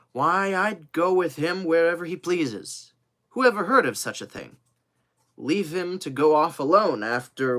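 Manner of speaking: loud, high, impassioned loyalty; fervent protest. Acted. Devoted servant defending going with his master, fervent protest. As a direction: plead the case with rising indignation.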